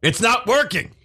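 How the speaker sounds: high panicked cry. Acted. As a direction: cry out in alarm. Power failure panic.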